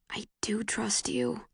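slow, breathless whisper; unvoiced, slow, wistful whisper. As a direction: barely voice it, slowly. Words she wants to say.

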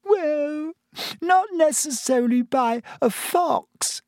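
high, teary schoolgirl voice; tearful. A narrator's reading. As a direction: talk through streaming tears. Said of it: "with streaming eyes".

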